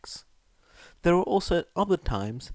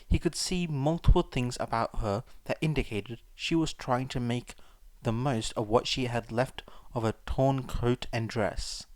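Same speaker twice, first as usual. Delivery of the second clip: usual speaking voice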